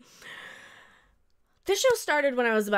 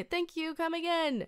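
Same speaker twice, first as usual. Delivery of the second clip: lightly mimicked Indian accent, higher and softer; kids' playground imitation of an Indian accent; 5 semitones higher, 5 dB quieter than usual. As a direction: softly put on a hint of an Indian accent. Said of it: "would always mimic the accent and say".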